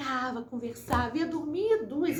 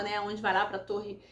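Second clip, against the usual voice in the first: ordinary talk, nothing marked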